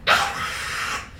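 short voiceless scream. Acted. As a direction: let out a pained cry with little voice. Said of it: "I screamed".

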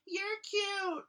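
put-on very high squeaky girl voice. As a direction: squeal it like a smitten fan. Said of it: "some girl that walks up".

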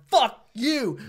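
high, loud mock curse; retold heckler yell. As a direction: shout it down at the host. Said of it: "the guy was yelling at me".

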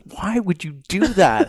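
high, slow exasperated dad voice; lightly voiced father, incredulous; baffled dad. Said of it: "He's like".

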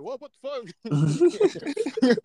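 high, loud, drawn-out what the fuck; shocked disbelief. Retold or imitated. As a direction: stretch out the outrage, shocked. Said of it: "And you like".

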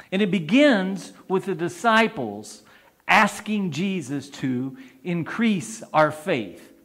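normal talking voice

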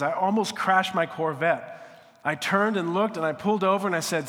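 loud, high, fast burst of shock; shocked retelling. Retold or imitated. Agassi recalling the pastor's words, loud and shocked. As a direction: blurt it out like you nearly crashed.

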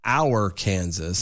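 "our Kansas" slowly, as two separate words; sounds like our Kansas. Shown as a demonstration. Co-host explaining the name's sound, "our Kansas" in two slow words. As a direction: draw out "our", then a full "Kansas". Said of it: "it's meant to sound".

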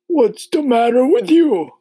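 put-on loud, high-pitched old grasshopper voice. A narrator's reading. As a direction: ask loudly as a storybook old insect. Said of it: "the old green grasshopper asked".

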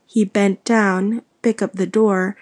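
ordinary delivery

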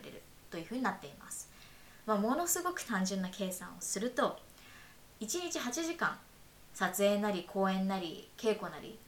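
clear, measured explainer read over music; walk the listeners through the system step by step; podcast narration. Acted. Host explaining the French arts subsidy to listeners, steady narration voice.

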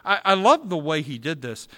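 normal talking voice

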